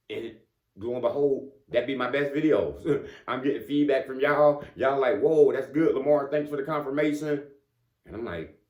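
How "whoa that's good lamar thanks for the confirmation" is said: higher, louder mock-viewer enthusiasm; imitated excited praise. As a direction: gush like an impressed follower.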